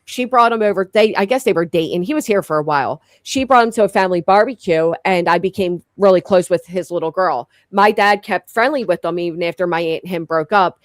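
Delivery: ordinary talk, nothing marked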